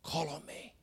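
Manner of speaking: breathy whispered urging; soft whispered invitation. Retold or imitated. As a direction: whisper it like a voice inside. Soft inner voice of God.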